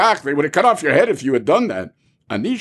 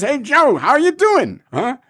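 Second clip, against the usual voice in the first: friendly greeting; 8 semitones higher, slower than usual. Retold or imitated. Friend greets you before king.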